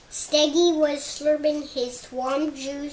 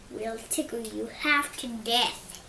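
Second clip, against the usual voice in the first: ordinary delivery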